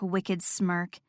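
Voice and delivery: ordinary delivery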